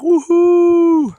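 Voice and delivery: loud high cheer of joy; excited whoop. Real, not acted. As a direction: shout a happy wouhou. Cheering at the sea.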